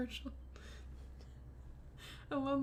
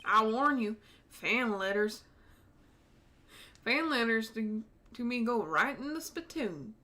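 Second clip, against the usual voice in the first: put-on Tennessee accent. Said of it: "I get to do that accent".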